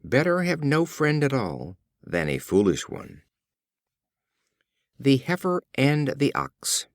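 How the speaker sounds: plain reading voice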